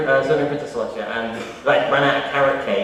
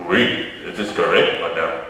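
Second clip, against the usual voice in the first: put-on French accent; 8 semitones lower than usual. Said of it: "it could be a, a little bit French".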